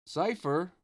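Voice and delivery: high, loud call after someone. A narrator's reading. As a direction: call him back across the hill. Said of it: "Olette called after him".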